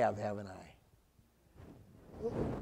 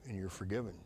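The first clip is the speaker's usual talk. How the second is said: a hint of a gentle voice; 14 semitones lower, 14 dB quieter, much faster than usual. Voice of God forgiving.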